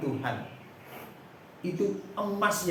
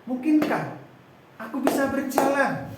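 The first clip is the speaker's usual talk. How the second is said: higher, louder astonished question; healed man amazed he might walk, higher and louder; amazed hope; 9 semitones higher, 5 dB louder than usual. Acted. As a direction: burst out in disbelieving joy. Lame man amazed at healing.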